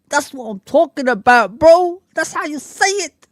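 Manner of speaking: hyped up. Real, not acted. Streamer hyped by a fan's message, pitched high and loud. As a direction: get fired up at the comment. Hyped reply to a chat comment.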